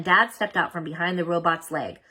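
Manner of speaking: plain reading voice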